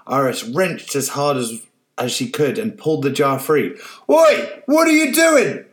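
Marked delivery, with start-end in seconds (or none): 4.1-5.6 much higher, loud furious bellow; angry old man bellowing. Acted. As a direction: roar it at the fleeing girl. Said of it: "the old man bellowed".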